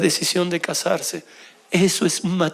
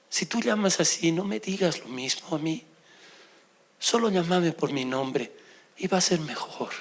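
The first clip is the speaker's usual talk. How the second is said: gentle request; 5 semitones lower, 6 dB quieter than usual. Retold or imitated. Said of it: "mi esposa que me dijo".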